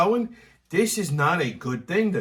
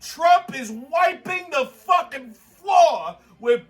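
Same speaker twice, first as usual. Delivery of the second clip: high, heated political tirade; real worked-up rant; ranting shout; 11 semitones higher, 3 dB louder, slower than usual. Real, not acted. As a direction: shout it emphatically, pitched way up.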